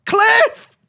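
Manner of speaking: high, loud cry of the name; excited shout. Real, not acted. Streamer celebrating a goal, loud high shout. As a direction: yell the scorer's name in joy. Calling the scorer.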